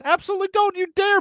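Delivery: very high-pitched playful protest; squeaky mock indignation; mock outrage. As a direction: object in exaggerated horror. Mock outrage at a boycott suggestion.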